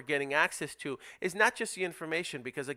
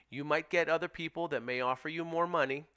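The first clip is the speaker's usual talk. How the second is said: ordinary talk, nothing marked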